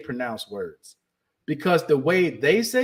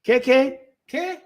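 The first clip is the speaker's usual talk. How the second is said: baffled locals; 8 semitones higher, slower than usual. Retold or imitated. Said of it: "they're gonna be like".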